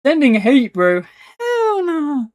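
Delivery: high-pitched, incredulous wail; indignant protest. Real, not acted. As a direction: blurt it loud, then wail the refusal. Protesting at being sent hate.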